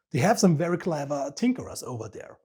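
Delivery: normal talking voice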